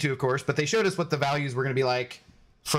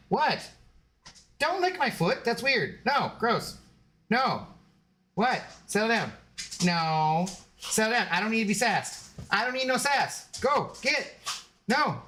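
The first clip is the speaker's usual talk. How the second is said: 1 talking to dog; 6 semitones higher than usual. Real, not acted. Talking to her dog about sass.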